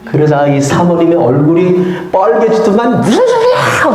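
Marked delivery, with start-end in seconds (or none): 3.0-3.9 high, sharp mock yell from the shocked wife; retold shriek. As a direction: shoot up high in mock outrage. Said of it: "소리를 지르는 거예요".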